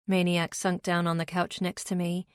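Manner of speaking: plain reading voice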